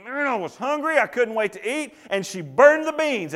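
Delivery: mock-annoyed inner voice; exasperated inner grumble. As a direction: fume silently about the burnt dinner. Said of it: "you're thinking".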